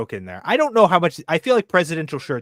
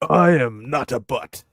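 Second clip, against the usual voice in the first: slow, lightly voiced Nixon; a hint of Nixon, drawn out; Nixon impression; slower than usual. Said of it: "Richard Nixon".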